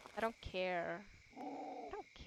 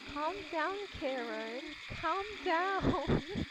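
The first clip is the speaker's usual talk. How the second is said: higher-pitched mock calm-down meme voice; meme quote; 8 semitones higher, 5 dB louder, much slower than usual. Player quoting a meme everyone knows, meme quote. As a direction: quote the meme, pitch jumping up on the last words. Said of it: "I think everyone knows the meme".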